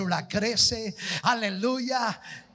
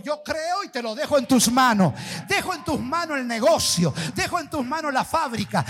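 rising loud fervent proclamation, building to a shout; preaching at full force; 4 semitones higher, 4 dB louder than usual. Real, not acted. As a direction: pour it all out, louder line by line. Climax of preaching. (predicación a todo pulmón, cada vez más fuerte)

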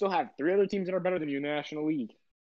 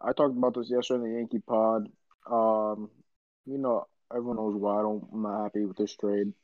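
lower, sleepy, dragged-out tone; groggy morning voice; 5 semitones lower than usual. Real, not acted. Host just woken up, talking trade news in a groggy low voice. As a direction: sounds like he just woke up and is down about it. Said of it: "is this your morning voice or something like that".

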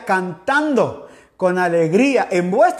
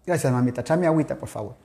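murmured request off-mic, much softer and lower; soft, low aside to a helper; quiet aside; 7 semitones lower, 6 dB quieter, much faster than usual. Real, not acted. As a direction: drop the voice for a side request. Aside to someone offstage.

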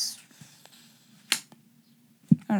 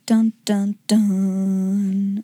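dramatic dun-dun-dun; 2 semitones lower, 4 dB louder, much slower than usual. Pretend. Mock dramatic sting after the ending.

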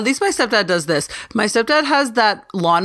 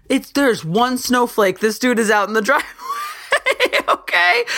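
ordinary talk

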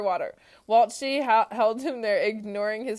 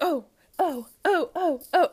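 repeated high little ohs; acted flustered dithering; 8 semitones higher, 5 dB louder than usual. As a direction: dither while dodging someone in an aisle. Acted aisle side-stepping dance.

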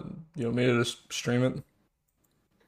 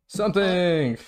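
loud shout; 7 semitones higher, 5 dB louder, much slower than usual. Shown as a demonstration. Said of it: "Say something?".

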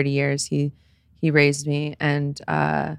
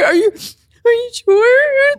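very high, slow, loud sobbed question; mock tearful; 7 dB louder, much slower than usual. Crying daughter doubting stepdad's joy, high tearful wail. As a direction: wail the question in exaggerated tears. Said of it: "Stop crying".

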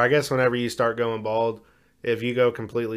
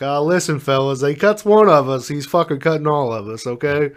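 higher, louder tough-guy voice rallying the others; rally your crew like a mob boss; hair roots gang boss; 4 semitones higher, 7 dB louder than usual. Pretend. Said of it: "They'd be like".

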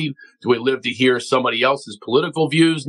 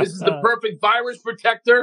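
mock proud boast; 8 semitones higher than usual. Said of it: "walking around Vegas with a chicken bone".

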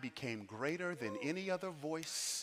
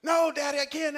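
put-on high, loud childlike protest; imitated crying children; 12 semitones higher, 7 dB louder than usual. Kid on the steps to Daddy, mock crying. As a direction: act out a child wailing in refusal. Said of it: "they're crying".

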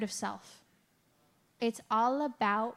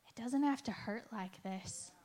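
much softer, tender hush; gentle whisper; 11 dB quieter than usual. Retold or imitated. God gently answering her on the floor, soft hush. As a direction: breathe it softly like comfort. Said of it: "I heard him whisper to my heart".

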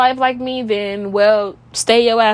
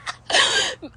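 breathless laugh through tears; laugh-sob; real tearful laugh; teary high laugh; 4 dB quieter than usual. Real, not acted. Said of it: "I'm crying".

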